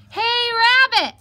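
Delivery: loud, sharp hail from outside; yelled call. Acted. Someone at the door yells for Rabbit, yelling. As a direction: yell it as if through a closed door. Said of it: "someone yelled outside".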